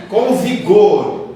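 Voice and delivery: Com vigor drawn out slow and firm. Shown as a demonstration. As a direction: stretch com vigor slowly, hitting each word. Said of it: "a gente tem que fazer as coisas com vigor".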